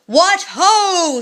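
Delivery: high, loud booming call; giant's yell. Acted. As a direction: yell it through the cave. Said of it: "screamed the giant".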